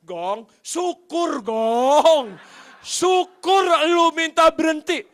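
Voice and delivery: loud, drawn-out gloating cheer; secret glee. Retold or imitated. Boss inwardly glad the driver quits, gloating shout. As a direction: crow it out slowly, mock-triumphant. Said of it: "Padahal di dalam hati saya".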